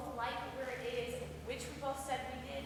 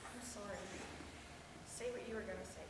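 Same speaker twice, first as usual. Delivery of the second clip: much softer, conceding; hushed, apologetic invitation; subdued apology; 5 semitones lower, 13 dB quieter, faster than usual. Acted. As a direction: back off quietly and let them speak. Apology after partner's outburst.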